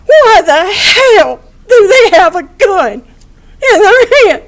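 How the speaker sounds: a real sob breaking through the question; choked, pleading cry. Real, not acted. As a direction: voice cracking up high, slow and tearful. Asks why the sick have guns.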